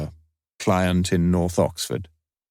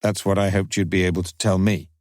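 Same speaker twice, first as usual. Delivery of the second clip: ordinary delivery, nothing marked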